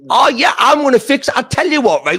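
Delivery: pompous mock stump-speech bluster; mock campaign promise. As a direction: play the swaggering candidate promising to fix everything. Mock politician promising fixes.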